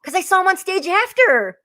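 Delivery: high, loud, drawn-out exclamation; real burst of laughing, squealed protest; laughing disbelief. Real, not acted. Laughing disbelief at her own reveal.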